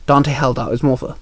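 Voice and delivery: ordinary delivery, nothing marked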